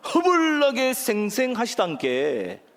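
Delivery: slow, stretched Jeolla accent; emphatic singsong Jeolla delivery; Jeolla dialect. Shown as a demonstration. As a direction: savor the Jeolla slang, drawing it out. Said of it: "또 있습니다".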